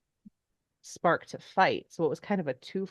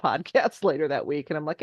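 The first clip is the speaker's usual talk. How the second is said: ordinary delivery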